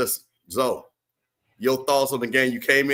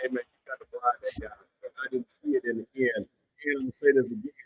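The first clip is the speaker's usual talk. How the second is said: muffled, quieter line; 5 dB quieter, faster than usual. Real, not acted. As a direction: voice muffled as if covered. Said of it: "You sound muffled".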